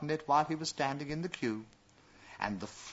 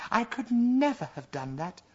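excited; 11 semitones higher, 5 dB louder than usual. A narrator's reading. Princess still thrilled.

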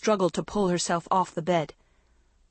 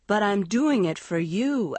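ordinary delivery

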